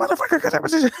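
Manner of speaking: high-pitched mock cursing outburst, through a laugh; imitating Danny Glover's cursing fit. Podcaster imitating the detective's jumping cursing fit, high and laughing. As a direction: squeal the curse like a man hopping mad. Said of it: "He's so mad, he's like".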